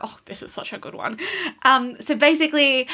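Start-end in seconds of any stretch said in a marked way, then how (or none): 0.0-1.2 whisper. Retold or imitated. Retelling her own thought.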